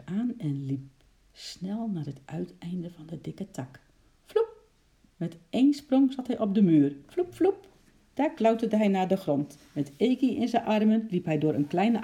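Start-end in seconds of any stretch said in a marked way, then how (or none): 4.2-4.6 very high "vloep" pop; whooshing leap noise. A narrator's reading. Chimp leaping onto the wall, high whoosh. 7.1-7.7 two very high "vloep" pops; whooshing leap noises. A narrator's reading. Chimp clambering down the wall, high whooshes. Imitated sound of leaps.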